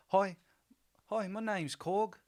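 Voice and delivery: put-on high-pitched New Zealand accent; Korg. Fan playing Korg as kinda the leader, Kiwi accent. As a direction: introduce yourself in a high Kiwi voice. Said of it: "Kiwi accent. Got it".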